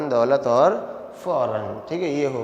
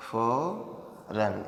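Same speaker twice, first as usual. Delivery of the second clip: drawn out slowly; 4 semitones lower, 9 dB quieter, much slower than usual. Shown as a demonstration. Teacher to students, dictating the word very slowly. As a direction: stretch फौरन out syllable by syllable, very slowly.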